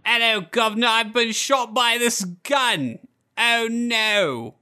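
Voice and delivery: high-pitched mock Cockney wail; slow, high, overdone Cockney accent. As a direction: stretch it out in hammy Cockney. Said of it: "giving all the stereotypical Cockney accents".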